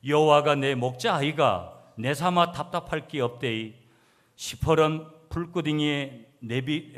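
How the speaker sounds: emphatic Gyeongsang-dialect recitation. Shown as a demonstration. Preacher reads second psalm version to congregation, in Gyeongsang dialect. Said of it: "경상도 버전 이 번".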